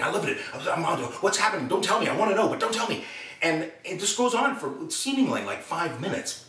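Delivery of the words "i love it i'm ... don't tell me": high-pitched manic fan; Robin Williams, excited barking. Retold or imitated. Star gushing at the actor about his show, excited barking. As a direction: tumble excitedly from thought to thought. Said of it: "barking at me".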